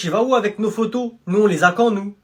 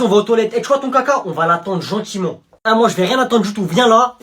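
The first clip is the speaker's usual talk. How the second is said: normal talking voice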